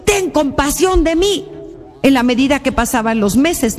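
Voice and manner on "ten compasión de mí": high-pitched call to Jesus; acted prayerful wail without extra volume; retold imploring cry. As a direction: cry out for mercy, stretching the last words. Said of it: "ella iba con su grito".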